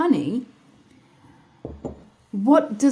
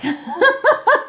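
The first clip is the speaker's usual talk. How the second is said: high laugh; 7 dB louder than usual. Real, not acted. Joking about Galinda.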